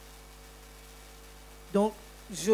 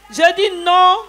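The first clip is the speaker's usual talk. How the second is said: firm shout; 8 semitones higher, 16 dB louder, slower than usual. Retold or imitated. Defiant refusal of the attack.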